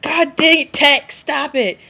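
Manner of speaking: high, loud, slowed scolding; mock frustration. The Volturi fed up with Tech, loud mock scolding. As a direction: scold her in pretend frustration. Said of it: "they'd be like".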